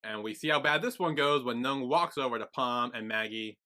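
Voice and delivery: ordinary talk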